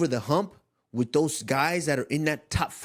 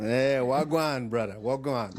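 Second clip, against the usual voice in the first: Jamaican patois greeting; slower than usual. Pretend. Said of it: "Every time I talk to my Jamaican brothers".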